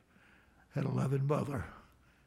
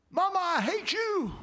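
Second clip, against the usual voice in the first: put-on high, loud child's yell, mama I hate you; retold child's scream; 5 dB louder than usual. Mother retelling her son screaming at her, high loud yell. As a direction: shriek it like an angry little boy. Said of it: "And he screamed at me".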